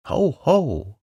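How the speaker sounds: a small animal puffing up to sound brave; fierce try. A narrator's reading. Piglet answering the call, would-be fierce ho ho. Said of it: "as fiercely as he could".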